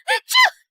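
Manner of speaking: acted sneeze. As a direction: burst out a big sneeze. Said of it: "tried desperately not to sneeze".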